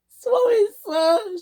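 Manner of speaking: very high, small apology; squeaky girl engine. Acted. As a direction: squeak out a sheepish sorry. Caitlin's very high voice.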